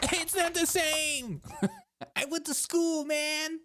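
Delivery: very high, drawn-out whiny protest; hammed-up squeaky complaint; whining doctor. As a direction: squeal it like a wounded know-it-all. Doctor whines back at Google remark.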